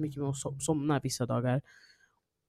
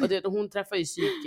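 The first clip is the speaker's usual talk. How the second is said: normal talking voice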